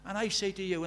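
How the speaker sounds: usual speaking voice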